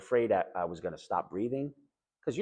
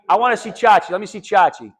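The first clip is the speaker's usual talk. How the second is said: louder, higher mock-crying request; imitating tearful begging; 8 semitones higher, 11 dB louder than usual. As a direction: beg to see the kid like a weeping patient. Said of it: "So just crying".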